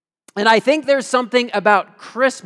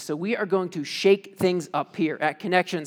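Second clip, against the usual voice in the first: ordinary talk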